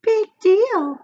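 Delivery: high, loud tempting little voice; pigtail voice. A narrator's reading. The pigtail coaxes Paul in a high little voice. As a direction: coax him like a tiny tempter. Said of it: "said the pigtail".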